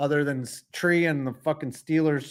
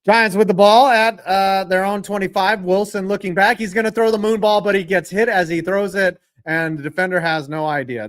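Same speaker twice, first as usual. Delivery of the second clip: louder, higher sportscaster call; play-by-play call; 6 semitones higher, 6 dB louder than usual. Acted. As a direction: call the drive like a TV announcer. Calls the game play-by-play.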